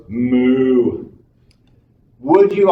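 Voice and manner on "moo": long, level imitated moo; cow's moo. Cow answering the visiting animal psychologist, drawn-out moo. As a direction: draw out a plain cow moo. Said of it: "And the cow goes".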